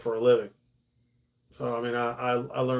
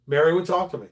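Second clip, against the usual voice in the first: normal talking voice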